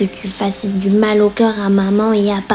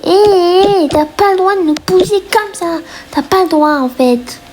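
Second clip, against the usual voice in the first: little one yelling that she can't push them, indignant; indignant protest; 9 semitones higher than usual. Acted. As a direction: shout back at the big sister. Kid protesting being pushed.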